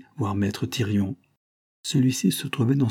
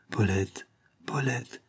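murmured name; 5 dB quieter, slower than usual. A narrator's reading.

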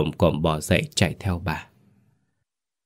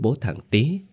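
ordinary delivery, nothing marked

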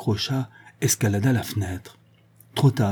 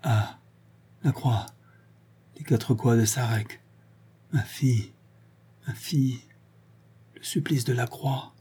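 faint stammering; 5 dB quieter than usual. A narrator's reading. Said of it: "il balbutia encore quelques mots".

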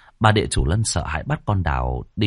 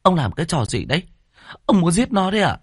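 high, alarmed protest; frightened; 11 semitones higher, faster than usual. A narrator's reading. Mother shields baby from husband, frightened. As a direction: cry out in alarm, pitch climbing steeply. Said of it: "Bà sợ hãi ôm chặt lấy con".

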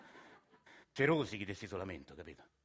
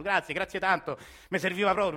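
higher, louder, sing-song thanks; mock grateful relative; 9 semitones higher, 8 dB louder than usual. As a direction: parrot the relatives' gushing thanks. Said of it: "ti ringraziano".

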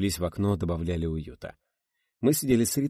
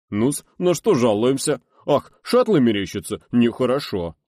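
high, loud mock doctor's bedside voice; mocking ship doctor; 6 semitones higher, 10 dB louder than usual. Co-pilot mocks the station doctor, high hammed-up voice. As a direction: lampoon a patronising doctor. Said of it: "Он талантливо передразнил стационарного бортврача".

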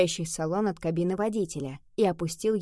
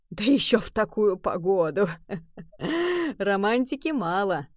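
amused remark broken by a chuckle; laughing. A narrator's reading. Taxi driver jokes to his passengers, laughing. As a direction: chuckle through the words. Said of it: "засмеялся он".

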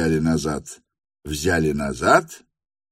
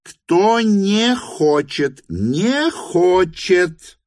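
"Кто не хочет, не хочет" high, hugely drawn out; exasperated emphasis; 12 semitones higher, 4 dB louder, much slower than usual. A narrator's reading. Hostess spells out "не хочет", slow high exasperated emphasis. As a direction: stretch each word of "не хочет", high and emphatic.

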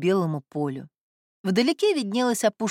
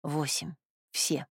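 tense whispered countdown; 4 semitones lower, 9 dB quieter, slower than usual. A narrator's reading. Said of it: "Лев шёпотом считал".

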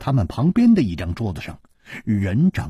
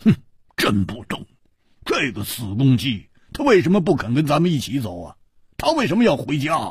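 huffy, acted complaint after a snort; higher, quick indignant questions; loud grumbling; 5 semitones higher than usual. A narrator's reading. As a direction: grumble at an absent friend, building in pitch. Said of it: "大声地说".

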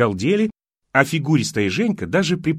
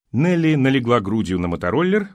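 ordinary delivery

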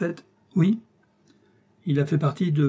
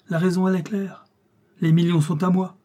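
higher, forceful retort; raised voice, brooking no argument; indignant insistence; 6 semitones higher, 4 dB louder than usual. A narrator's reading. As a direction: cut in and insist hotly. General cuts in, insisting on claim.

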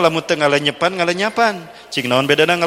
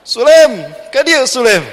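called loudly and higher across the mosque; calling out; 8 semitones higher, 6 dB louder, slower than usual. Acted. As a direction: call him over from afar. Sheikh calling out. (memanggil keras dari jauh)

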